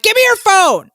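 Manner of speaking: imitating mom, loud and high; mock mom yell. Mom demanding daughter's phone to see the trailer, mock yell. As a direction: cry out a demand as an excited mom. Mom's excited demand, acted in story.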